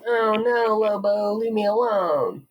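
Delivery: high, whiny pleading of a coward; mock whining. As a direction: whimper like a spoiled hero begging the bully off. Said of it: "super whining".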